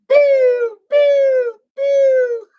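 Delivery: high, loud pew pew like a laser gun; shooting sound effect. Pretend. Reader zapping the scam alert card with laser sounds. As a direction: fire off playful laser zaps.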